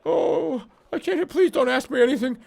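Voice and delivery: high, panicky pleading; a terrified seer begging off; panicked high voice. Acted.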